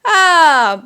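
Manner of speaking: loud high ah bending up then down; rising-falling ah. Shown as a demonstration. Teacher offering the ah version, loud. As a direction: sing the tone shape on ah. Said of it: "if you resonate with the "ah".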